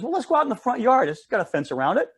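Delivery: lightly voiced girl coaxing her sister; a hint of a scheming little girl; eager little girl. Miriam voices a plan.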